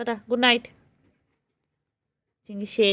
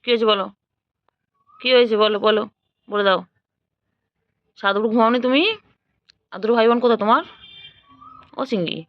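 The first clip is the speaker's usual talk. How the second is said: ordinary delivery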